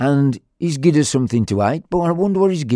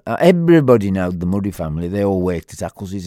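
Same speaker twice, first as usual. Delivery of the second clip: plain reading voice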